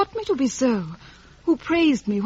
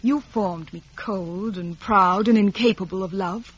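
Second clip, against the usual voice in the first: usual speaking voice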